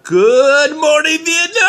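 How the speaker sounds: Robin Williams radio shout. Retold or imitated. Radio DJ greeting the troops, high loud hammed-up yell. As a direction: belt it like a wild morning radio host. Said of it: "Robin Williams and Adrian Cronauer".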